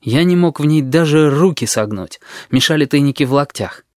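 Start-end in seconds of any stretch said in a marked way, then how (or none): none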